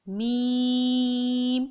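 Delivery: میم held very long on one steady note; five-alif stretch. Shown as a demonstration. Teacher demonstrating the length, long held میم. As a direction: draw out میم evenly. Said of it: "میم کو بھی پانچ الف".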